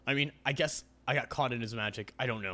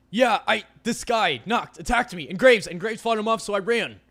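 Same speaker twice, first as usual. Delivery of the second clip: higher and louder, tumbling out; breathless rush; 9 semitones higher, 11 dB louder than usual. Acted. Avery tells Landry about the attack, rushed and shaken. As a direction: blurt out what happened, shaken.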